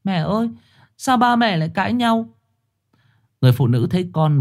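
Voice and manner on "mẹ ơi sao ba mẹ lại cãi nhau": put-on higher, innocent little boy voice; a scared child asking his mother; little boy comforting crying mother; small child. A narrator's reading. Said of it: "một đứa trẻ con chạy lại".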